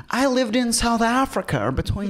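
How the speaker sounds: high-pitched, drawn-out American fan voice; mock American accent. Host mimicking Americans greeting the president, mock American accent. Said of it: "all these people are coming up to him and addressing him".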